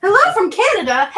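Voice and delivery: loud, bright hello; excited. Real, not acted. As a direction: call out the greeting happily. Excited greeting to chat.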